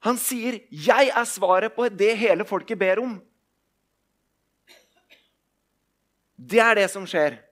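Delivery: loud, driving sermon voice; full-force preaching. Acted. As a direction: preach it out to the whole hall. (kraftfull forkynnelse)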